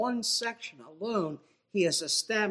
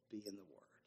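much softer, lower urging; quiet plea; 9 semitones lower, 21 dB quieter, faster than usual. Acted. Preacher softly pleading with his congregation. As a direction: say it close and gently. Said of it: "You must".

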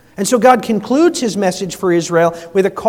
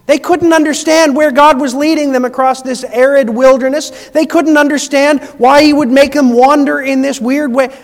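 higher and louder, driving the point home; preaching at full force; 5 semitones higher, 6 dB louder than usual. Acted. Preacher to congregation about Israel, rising, louder preaching.

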